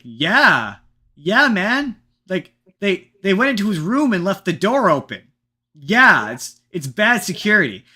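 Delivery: loud, high exasperated comeback; mock retort. Answering the warden with a loud, high exasperated comeback. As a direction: shout back at him that he's obviously wrong.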